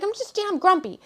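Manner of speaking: grumpy grumble. Retold or imitated. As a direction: whine it like a cranky kid. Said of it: "I was like".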